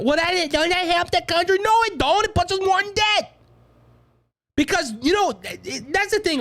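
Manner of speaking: high, fired-up retort; heated. Real, not acted. Host scorns student debt relief to his audience, heated. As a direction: answer your own question with scorn. Mock question then answer.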